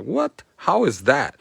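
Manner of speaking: high-pitched mock disbelief on "What?"; baffled reaction. Teacher voicing a puzzled viewer's reaction, mock bafflement. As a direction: act out a confused listener's surprise. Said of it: "You're like".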